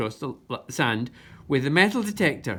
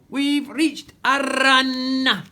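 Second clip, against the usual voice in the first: very slow, loud, high pirate cry; stretched-out joyful pirate shout; triumphant yell; 9 semitones higher, 6 dB louder, much slower than usual. A narrator's reading. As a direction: shout it to the whole crew. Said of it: "yelled Captain Shugle".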